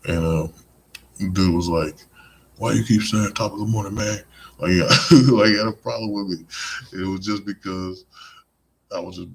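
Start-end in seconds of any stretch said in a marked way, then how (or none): 2.6-4.2 annoyed challenge. Retold or imitated. Acted complaint of annoyed dude.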